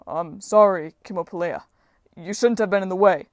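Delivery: lightly voiced blundering giant; loud but sheepish giant apology; apologetic giant. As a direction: excuse yourself in a hint of giant's voice. Giant apologizes for hitting goddess.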